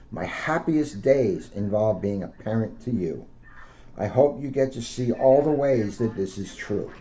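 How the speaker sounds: picture-book read-aloud, gently lifted; reading to children. Acted.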